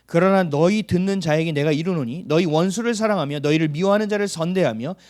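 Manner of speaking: loud, solemn Bible reading; preacher reading the Gospel aloud; scripture reading. Acted. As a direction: declaim the verses from the pulpit.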